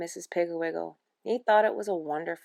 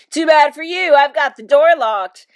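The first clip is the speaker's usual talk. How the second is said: loud, higher gloating taunt; rude calling out; 7 semitones higher, 14 dB louder than usual. A narrator's reading. Father taunts mother from behind a locked door, rudely calling out. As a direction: call it out smugly. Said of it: "laughed rudely and called out".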